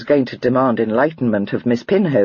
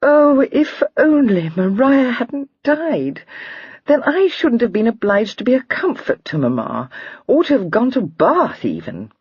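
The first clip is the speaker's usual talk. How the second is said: fighting back tears over her sister; tearful; 9 semitones higher than usual. A narrator's reading. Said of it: "had to wink away a tear".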